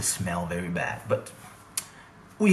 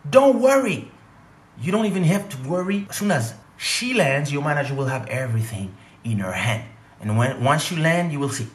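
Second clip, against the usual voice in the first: high, loud, over-eager reassuring voice; mock promoter; 5 semitones higher, 5 dB louder than usual. Promoter soothing the comedian on the phone, high mock reassurance. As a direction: ham up a slick promoter's promise.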